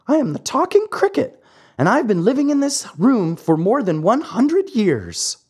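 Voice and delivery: put-on an old, patient cricket introducing himself; wise talking cricket. Cricket's held voice.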